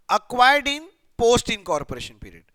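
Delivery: slow question reading. Shown as a demonstration. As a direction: lean on each word so students catch it. Rereads question phrase. (धीरे और ज़ोर से प्रश्न दोहराते हुए)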